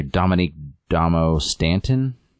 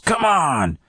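mock yell; 12 semitones higher than usual. Tarp detective in a shootout, high mock yell. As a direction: cry out like a frustrated cartoon cop. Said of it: "whatever yells".

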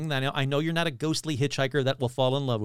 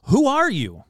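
excited; 9 semitones higher, 7 dB louder than usual. Acted. Kurt startled by Bigfoot.

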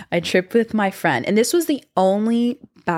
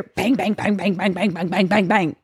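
rapid-fire string of identical bangs; imitated banging noise. Storyteller mimicking sudden crashes from the bathroom, rapid-fire banging. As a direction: rattle off the bangs like repeated thuds. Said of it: "all of a sudden I just hear".

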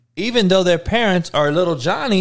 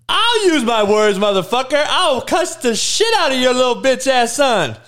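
higher-pitched indignant retort; mock angry comeback; 7 semitones higher than usual. Talking back to gentle parent, mock outrage. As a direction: fire back, acting outraged.

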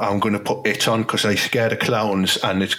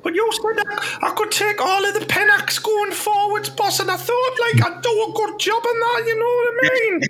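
much higher squeaky player voice; timid young player. Acted. Player calling to his manager from the toilet, high timid voice. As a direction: answer the boss meekly from the toilet.

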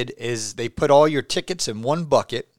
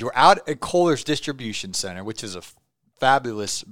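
ordinary delivery

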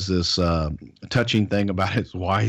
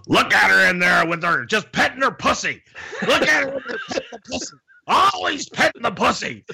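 Freddie's voice; 12 semitones higher than usual. Retold or imitated. Freddie grumbling from the next room about his wife. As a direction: bark it like a cranky old heel. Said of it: "you go".

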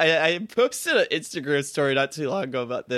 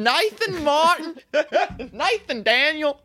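much higher, louder, slow full-name call; scolding Southern mom; 6 dB louder, slower than usual. Retold or imitated.

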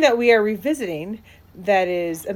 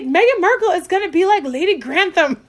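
high, loud fan gushing; excited realisation; 8 semitones higher, 5 dB louder than usual. Retold or imitated. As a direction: announce it like a thrilled fan. Acting out her excited realisation.

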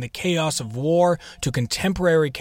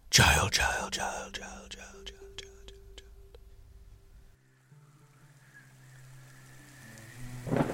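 self-made echo; 10 semitones lower, 11 dB quieter, much slower than usual. Shown as a demonstration. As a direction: voice repeats and fades into a whisper like an echo. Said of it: "That wasn't a special effect. That was me".

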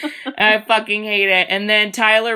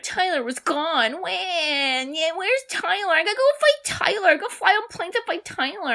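mock frantic search; 9 semitones higher, 4 dB quieter than usual. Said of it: "And then he's like".

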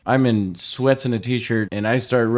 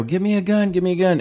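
high-pitched imitation of yelling; retold screaming; 7 semitones higher, faster than usual. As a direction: re-enact an urgent call, pitched up. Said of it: "screaming to Marital".

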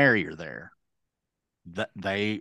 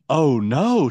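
gasp, then higher dismay; retold shocked sympathy; 3 semitones higher, 5 dB louder than usual. Wife hearing the truck was towed, gasping shocked sympathy. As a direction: imitate a gasping, dismayed listener. Retold wife's reaction to news.

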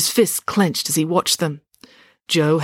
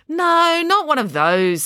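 high, emphatic impatient no; 12 semitones higher, 4 dB louder, slower than usual. A narrator's reading. April brushes off Finn's bear trap worry, impatient. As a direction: dismiss it impatiently, starting high and loud. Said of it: "said April impatiently".

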